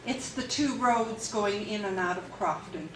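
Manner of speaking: ordinary delivery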